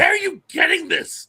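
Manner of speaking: high, loud, drawn-out incredulous question; acted astonishment, voice jumping up; mock disbelief. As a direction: slowly cry out in baffled amazement. Mock disbelief at character's fantasy.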